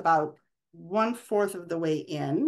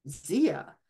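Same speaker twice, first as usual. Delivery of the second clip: a touch of reproach in "Zia"; a lightly voiced scolding call of the name; reproachful. A narrator's reading. A woman at the table lightly scolding Zia for leaving.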